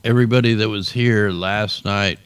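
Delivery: ordinary talk, nothing marked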